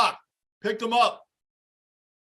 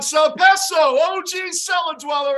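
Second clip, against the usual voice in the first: shouted greeting; 8 semitones higher, 6 dB louder than usual. Real, not acted. Greeting chat member by name.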